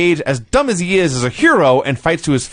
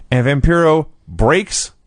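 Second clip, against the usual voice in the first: usual speaking voice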